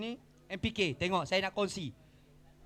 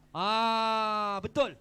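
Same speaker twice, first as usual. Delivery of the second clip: ordinary talk, nothing marked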